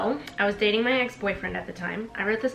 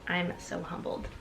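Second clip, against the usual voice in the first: whispered; 3 semitones lower, 9 dB quieter than usual. Retold or imitated. Soft end of grateful entry.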